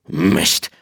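a light grumble at the missed catch; mild curse. Acted. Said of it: "fluchte der".

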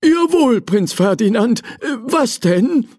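high, eager-to-serve answer; lightly voiced obliging old servant. Servant Hugo answers the prince, eager and high. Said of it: "erwiderte Hugo".

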